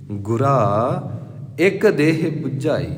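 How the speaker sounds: ਗੁਰਾ drawn out very slowly, then a pause before ਇੱਕ ਦੇਹ ਬੁਝਾਈ; slow recitation. Shown as a demonstration. Preacher models the verse for listeners, slow and paused. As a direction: hold ਗੁਰਾ as a call, then go on. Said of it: "ਇਹਨੂੰ ਬੁਝਾਈ ਨਹੀਂ ਕਹਿਣਾ".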